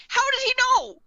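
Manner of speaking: high, loud disbelieving cry; incredulous outburst. Retold or imitated. Reacting to L knowing about Kira, incredulous. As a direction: blurt it out, pitch shooting up.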